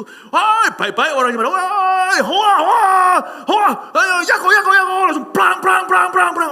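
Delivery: high, loud, rowdy shouting chatter; preacher mocks noisy Hong Kong restaurant crowd, high and loud; noisy Hong Kong diners. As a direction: imitate boisterous diners yelling across a table.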